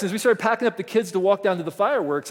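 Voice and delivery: ordinary talk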